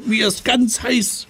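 high protesting cry; indignant shout. Acted. Seppel protesting the beating, high indignant cry. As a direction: yelp that enough is enough. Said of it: "schreit er".

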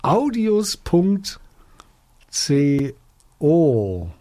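slow readout of a web address; drawn out, with pauses between the parts; slow dictation. Real, not acted. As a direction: spell it out slowly, piece by piece.

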